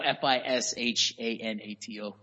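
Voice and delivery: spelled out letter by letter, slowly; slow, deliberate spelling. Shown as a demonstration. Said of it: "I spelled aficionado".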